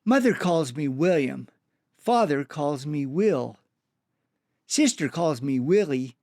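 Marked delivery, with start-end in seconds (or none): none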